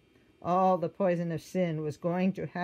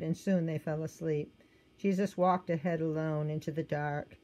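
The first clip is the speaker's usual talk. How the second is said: usual speaking voice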